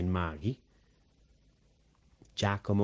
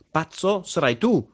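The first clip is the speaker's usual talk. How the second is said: higher, louder comeback in Italian; indignant retort; 8 semitones higher, 5 dB louder than usual. A narrator's reading. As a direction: hurl the insult back, loud and high.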